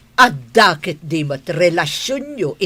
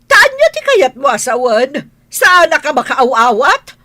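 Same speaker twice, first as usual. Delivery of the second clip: irritated demand; 8 semitones higher, 6 dB louder than usual. Acted. Madam irritated by rambling maid.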